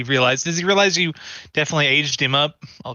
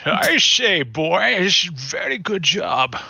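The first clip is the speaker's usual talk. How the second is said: Deckard Cain; 2 semitones higher, much slower than usual. Acted. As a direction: drawl it like a wise old sage. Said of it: "You've got the voice and everything".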